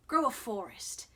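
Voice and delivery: usual speaking voice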